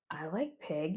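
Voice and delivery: low menacing murmur, barely voiced; unfriendly low growl. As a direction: growl it softly like a hungry wolf. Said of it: "not in a friendly way".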